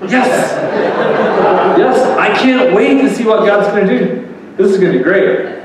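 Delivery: giddy, laughing yes to every trial; excited new creature. Acted. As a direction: cheer it like trials are a gift. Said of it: "The new creature goes".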